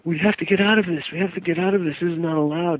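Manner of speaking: high, frantic little creature voice; scared creature wanting to escape; trembling creature. Retold or imitated. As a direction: panic like a cornered imp. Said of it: "he's shaking and trembling".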